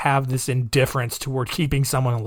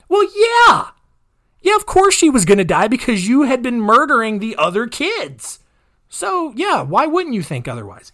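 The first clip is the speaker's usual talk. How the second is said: sarcastic; 8 semitones higher, 3 dB louder than usual. Real, not acted. Sarcastic reaction to killer's excuse.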